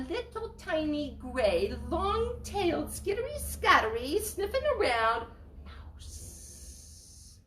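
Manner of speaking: high, slow whispered mouse words; mousey voice. Acted. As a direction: shrink into a sniffing little mouse. Describing tiny mouse.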